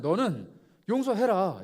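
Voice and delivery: slow, loud, deliberate command; firm command. Retold or imitated. Jesus commanding the disciples, slow and firm. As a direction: lay down the command.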